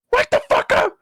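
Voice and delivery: very high, shrill-pitched mock shout; playful high scream at a sleeping cat. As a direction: shriek the cat awake in fun. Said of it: "And I'm like".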